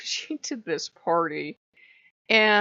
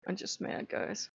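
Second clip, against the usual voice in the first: muttered frustration; 10 dB quieter than usual. Real, not acted. Venting frustration to viewers.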